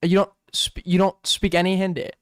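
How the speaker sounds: ordinary talk, nothing marked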